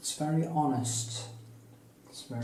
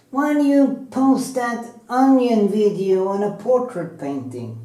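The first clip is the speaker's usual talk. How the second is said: mocking a critic; 9 semitones higher, 10 dB louder than usual. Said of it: "this guy had to".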